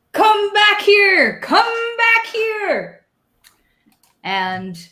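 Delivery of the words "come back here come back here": high, slow furious yell; screaming farmer. Acted. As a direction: bellow after them like an angry farmer. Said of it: "Farmer Krennic is still screaming".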